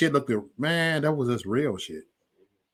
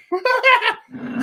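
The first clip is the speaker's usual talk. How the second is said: high laugh. Real, not acted. Laughs at own timeout joke.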